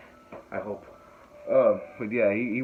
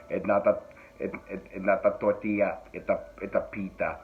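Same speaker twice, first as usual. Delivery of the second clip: low, halting robot voice; Terminator-like robot; 4 semitones lower than usual. Acted. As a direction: stop and start like a deep-voiced machine. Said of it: "it was a T one oh one".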